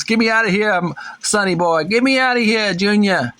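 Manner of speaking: playful high cry of a trapped gorilla dad; caged Donkey Kong. Acted. Caged Donkey Kong calls for rescue.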